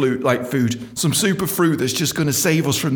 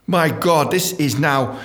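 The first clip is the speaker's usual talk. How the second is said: normal talking voice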